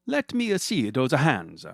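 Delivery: put-on lightly done Italian accent, slow and admiring; hammed-up Italian accent. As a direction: play a cartoonish Italian photographer. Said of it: "stereotypically and probably offensive accent".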